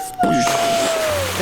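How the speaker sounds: high mock splash sound; high splash noise. Host voicing the splash as the boat drops.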